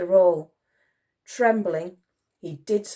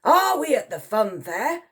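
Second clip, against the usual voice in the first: high, loud officer's question; indignant Brigadier; 7 semitones higher, 5 dB louder than usual. A narrator's reading. Brigadier objecting to another go, indignant. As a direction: ask it as a scornful officer.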